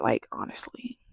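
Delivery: low, whispery and soft; muttered aside. Real, not acted. Speaker trailing off about investigating the professor, muttered aside. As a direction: let it sink into a whisper.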